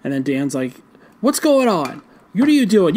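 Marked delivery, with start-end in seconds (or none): 1.2-2.9 higher, startled protest, lightly acted; mild mock alarm. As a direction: question it in imitated bewilderment. Voicing Dan's startled protest.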